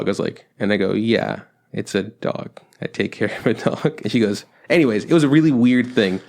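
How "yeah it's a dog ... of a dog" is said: slow and a bit softer, spelling it out; slow spelled-out. Retold or imitated. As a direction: slowly repeat it for the confused lady. Spelling it out for the lady.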